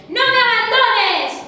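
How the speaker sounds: shouted high and loud, slowly; cling on and yell it, begging; desperate shout. A narrator's reading. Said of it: "Y el príncipe gritó". (grito desesperado, agudo y fuerte)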